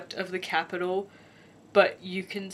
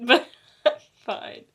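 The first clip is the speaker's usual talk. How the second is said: laughing it off, louder; 3 semitones higher, 5 dB louder than usual. Real, not acted. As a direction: laugh through the words. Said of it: "Well, I'm not fine".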